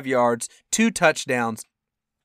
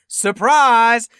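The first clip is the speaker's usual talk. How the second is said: high, loud, drawn-out reveal; spring it like a gleeful surprise, stretching the words; mock surprise announcement; 8 semitones higher, 8 dB louder, much slower than usual. Game-day news sprung on a stunned fan, mock surprise announcement.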